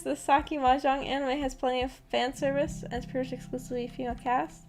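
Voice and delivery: ordinary talk, nothing marked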